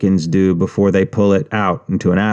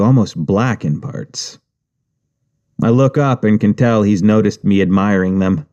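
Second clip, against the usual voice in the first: ordinary delivery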